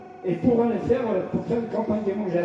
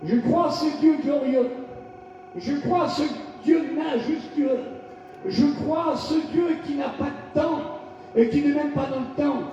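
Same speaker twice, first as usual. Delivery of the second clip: fervent, rising sermon cry; shouted declarations of faith; full-force preaching; 5 semitones higher than usual. Acted. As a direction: proclaim each line to the congregation.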